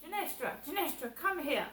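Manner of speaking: loud, high mock shout of a name. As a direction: bellow the name across the flat.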